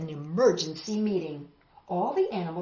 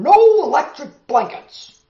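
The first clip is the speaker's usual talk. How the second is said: loud, firm refusal; farmer refusing; 8 semitones higher, 15 dB louder than usual. Acted. Farmer refusing the cows' demand, loud no. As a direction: shout it flat out. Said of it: "said Farmer Brown".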